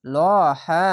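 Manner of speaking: লহা with a thick, full ল; heavy lam. Shown as a demonstration. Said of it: "মোটা করে পড়লাম".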